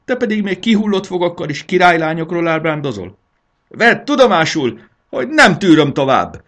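high, loud, furious scolding; blaring tirade. A narrator's reading. Dragon wife scolds her daydreaming husband, blaring tirade. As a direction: thunder out the grievances at full force.

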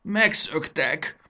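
loud, slow rasp of horror; strangled outcry while reading. Acted. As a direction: rasp it out high, stretching the word. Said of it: "olvasott bele hörgő hangon".

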